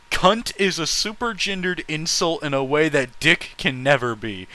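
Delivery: ordinary talk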